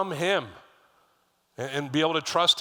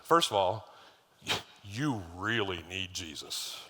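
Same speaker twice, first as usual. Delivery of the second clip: softer and slower, with a chuckle on really; laughing; 6 semitones lower, 4 dB quieter, slower than usual. Retold or imitated. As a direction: tease him gently, laughing through it. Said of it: "I was like".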